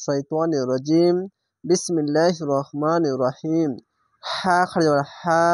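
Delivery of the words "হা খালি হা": হা stretched one alif, slow and sung; drawn-out recitation. Shown as a demonstration. Teacher showing students how to stretch হা. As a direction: draw out হা like a Quran teacher. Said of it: "এক আলিফ টেনে পড়বেন".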